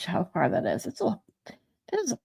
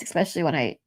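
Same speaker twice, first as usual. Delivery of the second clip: usual speaking voice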